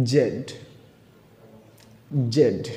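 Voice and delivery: British "জেড", ending in a d sound; name the letter Z as British "জেড". Shown as a demonstration. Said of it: "ব্রিটিশরা". (British উচ্চারণে "জেড")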